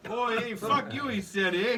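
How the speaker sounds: high-pitched mock Canadian retort. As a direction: imitate an offended Canadian. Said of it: "pissed off a Canadian".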